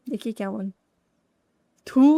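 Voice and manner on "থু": spitting in disgust. Real, not acted. Spits out bad noodles.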